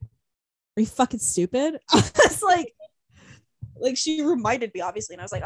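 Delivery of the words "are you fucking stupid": slow, deliberate question with rising end; incredulous mom. Retold or imitated. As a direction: imitate a mom slowly asking in disbelief. Imitating mom's disbelief at her.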